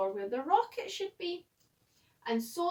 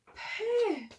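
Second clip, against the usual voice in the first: high, loud squeal of a word; comic punchline; 9 semitones higher, 4 dB louder than usual. A narrator's reading. Narrator revealing the silly punchline with a high squeal. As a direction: squeak it out for the giggle.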